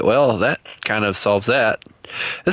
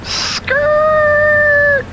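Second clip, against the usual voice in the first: shout; 5 dB louder than usual. Pretend. Shouting while driving game.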